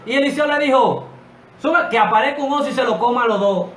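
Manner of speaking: high, loud preacher's cry; fiery preaching. Acted. Preacher stirring up his congregation, loud fiery preaching. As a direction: preach it to the rafters, full force.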